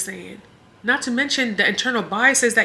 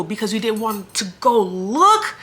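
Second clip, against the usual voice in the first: ordinary talk, nothing marked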